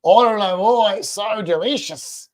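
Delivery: slow, drawn-out Australian bloke voice. Pretend. Owner voicing the lizard as an Australian bloke, slow. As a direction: relish it in a mock Australian accent. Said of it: "she's an Australian bloke".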